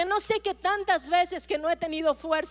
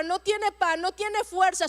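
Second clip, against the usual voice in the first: normal talking voice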